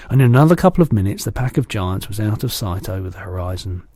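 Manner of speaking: ordinary delivery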